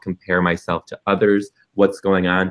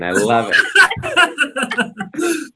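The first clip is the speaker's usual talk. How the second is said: laughing; much slower than usual. Real, not acted. Delighted at Fast Five.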